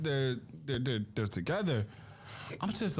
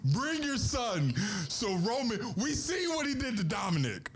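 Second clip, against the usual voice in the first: sing-song taunt; 9 semitones higher than usual. Acted.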